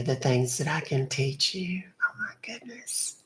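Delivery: hushed, suggestive whisper. Acted. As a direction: whisper it like a naughty secret. Flirting, lowers voice secretively.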